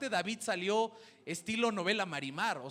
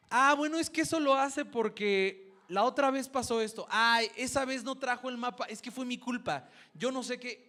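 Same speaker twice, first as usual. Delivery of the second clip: higher, louder, fussy aunt making excuses; excusing aunt; 5 semitones higher, 5 dB louder than usual. Retold or imitated. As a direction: gush out excuses like a doting aunt. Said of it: "Viene la tía".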